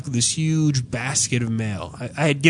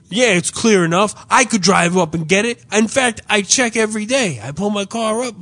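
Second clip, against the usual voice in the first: retold indignant protest, higher pitch; irritated customer; 5 semitones higher, 3 dB louder than usual. Irritated customer arguing with the post office clerk, indignant. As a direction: imitate an exasperated man arguing his case. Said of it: "that's why everybody's irritated".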